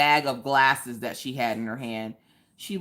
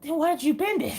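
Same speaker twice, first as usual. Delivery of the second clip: teasing challenge; 12 semitones higher than usual. Real, not acted. Teasing husband's excuse.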